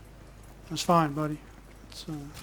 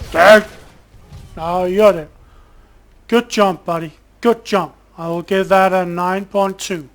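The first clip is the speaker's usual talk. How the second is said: gleeful taunting; 7 dB louder than usual. Real, not acted. Mocking cheers at enemy deaths.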